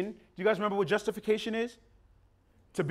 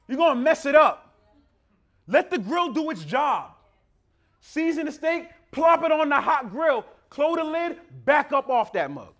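loud, higher fiery sermon; preaching at full force; 7 semitones higher, 6 dB louder than usual. Acted. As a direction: drive the warning home to the congregation.

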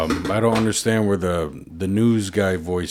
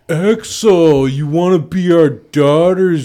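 loud, high, drawn-out mocking voice; mocking couple impression; 6 semitones higher, 7 dB louder, much slower than usual. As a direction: stretch it out high and loud, mimicking an obnoxious couple. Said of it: "they're just like".

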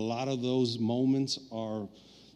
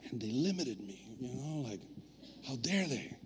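soft sulky mock grumble, much quieter; 12 dB quieter than usual. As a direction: grumble to yourself like a sulking kid. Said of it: "I was just like".

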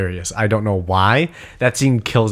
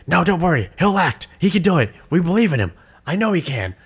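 reassuring teammate, higher; 7 semitones higher than usual. Retold or imitated. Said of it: "somebody's like".

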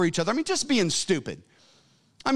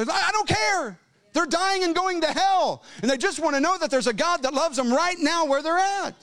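impassioned preaching; 12 semitones higher than usual. Acted. Preacher crying out to the congregation over the lost. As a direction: pour it out at full force.

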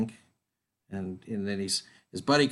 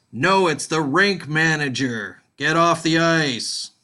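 loud, slow, higher stern order; rink manager; 5 semitones higher, 7 dB louder, slower than usual. Acted. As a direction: bellow it like a rink manager clearing the ice.

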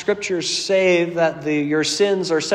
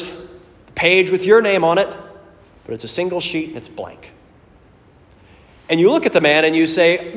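ordinary delivery

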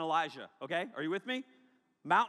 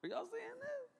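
amazed disciple; 9 dB quieter than usual. Acted. Disciples amazed at shining face.

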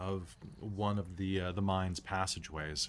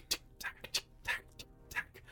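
pickaxe tick-tack; 8 dB quieter than usual. Shown as a demonstration. GM imitates pickaxe sounds.

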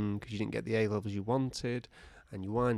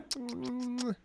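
high, soft little hum; small thin squeak through closed lips; mouse squeak; 12 semitones higher, 6 dB quieter than usual. Acted. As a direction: imitate a tiny mouse noise. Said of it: "and the mouse goes".

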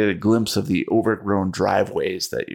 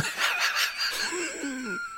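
high cackling laugh; 10 dB quieter than usual. Acted. Driver laughs after naming song.

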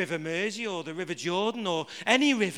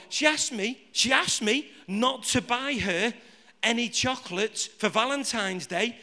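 ordinary talk, nothing marked

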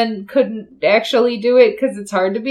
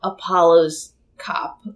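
whispering; 7 semitones lower, 6 dB quieter, slower than usual. Real, not acted. Whispered aside.

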